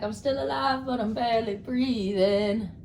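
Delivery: high drawn-out pet voice, half-sung; voicing Ruby. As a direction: stretch it out as a pet barely alive. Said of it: "Ruby's like".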